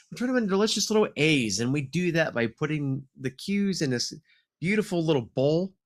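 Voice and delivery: lively show-host welcome; announcer intro. Acted. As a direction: hype up the show opening like a host. Podcast intro announcer read.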